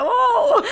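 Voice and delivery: high, loud mock "oh"; imitated crowd reaction. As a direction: hoot like an impressed crew, playfully. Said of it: "a galera fica".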